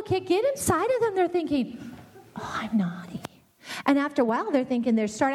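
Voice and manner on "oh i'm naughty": put-on a breathless whisper of a child's thought.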